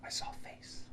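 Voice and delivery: barely voiced whisper; stunned whisper. Terrified partner answering after waking screaming, whispered.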